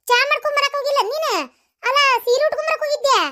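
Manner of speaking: high-pitched, exasperated rebuke; indignant scolding. Acted. Mother-in-law catching daughter-in-law in the saree, indignant scolding.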